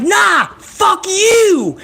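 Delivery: high, drawn-out imitation of a comeback; exaggerated mock shouting match; mock defiant yell. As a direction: stretch out the hammed-up retort. Acted in-ring quarrel.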